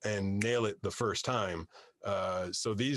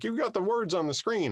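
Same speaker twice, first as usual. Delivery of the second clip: high mock voice; 12 semitones higher than usual. People telling him about screen.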